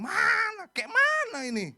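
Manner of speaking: high, very loud mock-indignant yell; hammed-up angry demand for answers; loud complaint. Israelites complaining straight to God, acted outburst. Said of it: "mereka komplain langsung sama Tuhan".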